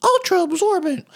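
high ad woman voice; breathy commercial lady; woman's voice. Retold or imitated. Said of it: "actually, it's a woman's voice".

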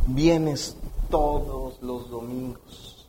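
low, soft, drawn-out list; hushed, very slow recital; slow solemn listing. Shown as a demonstration. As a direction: draw each phrase out slowly and low. Preacher slowly lists churchgoer's merits.

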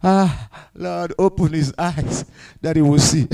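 soft, low, unhurried prayer; calm, hushed request to God; relaxed. Retold or imitated. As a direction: murmur it like a man totally at ease. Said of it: "The man of God relaxed".

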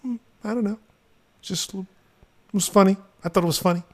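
put-on high girlish teen voice; daughter voice. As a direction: play the shrugging teenage daughter.

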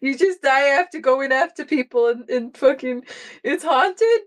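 high, frantic mock panic; hammed-up dread of certain death; panicking. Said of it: "she's like".

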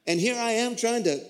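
normal talking voice

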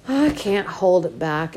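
ordinary delivery, nothing marked

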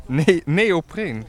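"neopreen" drawn out slowly; slow clarifying repeat. Real, not acted. Repeats word after listener's "Wat?.

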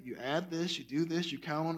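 put-on gently walk a kid through the sum; patient teacher. Teacher patiently explaining math to a child, soft and patient. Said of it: "You patiently say".

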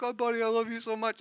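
high, tearful imitation of a relieved parent; mock crying. Dad hugging his found child, imitated crying. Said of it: "I'm, like, crying".

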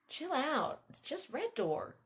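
high, drawn-out brush-off; amused teasing. A narrator's reading.

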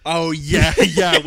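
ordinary talk

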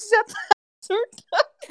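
laughing. Real, not acted. Laughing about the food debate.

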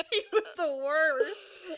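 slow, loud, high disgust; drawn out disgust. Real, not acted. Friend groans about a hated Love Island contestant, slow disgust. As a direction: drag out the worst with loathing. Hating on a reality star.

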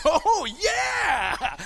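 high, loud fake-enthusiastic "Oh, yeah!"; excited shout. Dad cheering daughter's hoodie gift, loud and high. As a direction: yell it with put-on delight at a gift you don't get. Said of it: "and I'm like".

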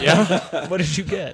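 high, loud laughing question. Real, not acted. GM asking the player's roll, laughing high. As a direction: ask through laughter.

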